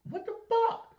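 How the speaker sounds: high, loud squealed what the fuck; staged shocked squeal; shocked outburst. Pretend. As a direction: shriek it in disbelief. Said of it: "Gotta say it one more time".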